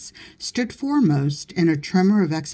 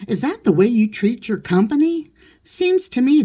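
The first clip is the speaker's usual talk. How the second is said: high, loud mock-stern rebuke; indignant scolding; 7 semitones higher, 3 dB louder than usual. A narrator's reading. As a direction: scold the valet sharply. Master scolds the valet.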